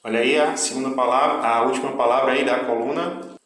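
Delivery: normal talking voice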